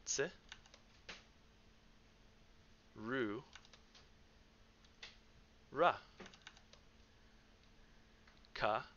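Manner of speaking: one syllable at a time, spaced out; staccato. Shown as a demonstration. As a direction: say each syllable alone with long pauses. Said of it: "through it syllable by syllable".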